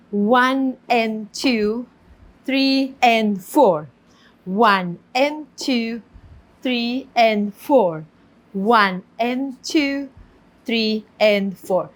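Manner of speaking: slow, even count of the steps. Shown as a demonstration. Teacher counting the step slowly for students. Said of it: "if we count the step".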